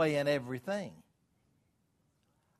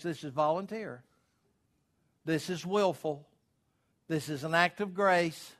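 ordinary talk, nothing marked

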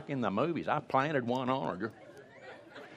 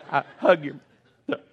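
louder, clipped and cross, syllables clipped; upset mother; 4 semitones higher, 6 dB louder, faster than usual. Retold or imitated. Mother losing patience with her teenage son, clipped and cross. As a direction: snap the phrase out short. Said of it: "getting upset when they skip syllables".

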